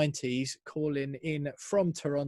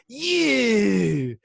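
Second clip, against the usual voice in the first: mock dying giraffe; 8 semitones higher, 10 dB louder than usual. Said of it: "Sounds like a dying giraffe".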